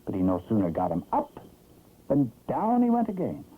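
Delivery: usual speaking voice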